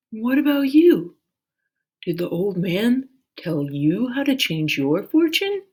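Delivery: the dragon turns curious to her; dragon's question. A narrator's reading. Dragon asking Minley about her fortune, curious. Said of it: "the dragon asked".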